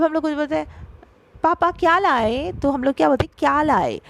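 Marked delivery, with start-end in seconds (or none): 1.4-2.5 क्या lifted high in the question; stress on क्या. Shown as a demonstration. Teacher voicing a child's question to papa, क्या stressed. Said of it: "क्या चीज़ पे ज़्यादा जोर दिए". 3.4-4.0 क्या raised, लाए falling after it; stress on क्या. Shown as a demonstration. Teacher showing what we emphasise when asking, क्या stressed. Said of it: "क्या चीज़ पे ज़्यादा जोर दिए".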